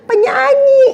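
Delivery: high, loud mock diva squeal; squealed singer. (pekikan tinggi ala penyanyi)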